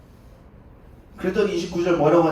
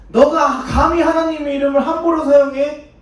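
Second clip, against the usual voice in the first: outraged; 9 semitones higher, 7 dB louder than usual. Acted. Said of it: "이 사람들이 열받은 거예요".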